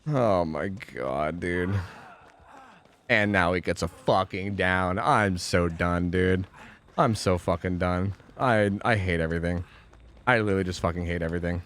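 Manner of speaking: low, slow, deflated grumble; real dejected stream rant; defeated despair. Real, not acted. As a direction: sink into defeat, dragging the words. Injured, lost rescue, defeated.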